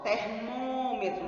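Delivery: "Termômetro" slow, holding a closed "mô"; closed vowel. Shown as a demonstration. Teacher showing the closed sound, slow "Termômetro" with held ô. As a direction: lengthen the stressed closed ô of "Termômetro".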